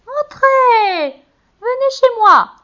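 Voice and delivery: very high, loud cackling witch invite; high loud hag voice beckoning; witch luring. A narrator's reading. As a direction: lure them in like a sly old witch.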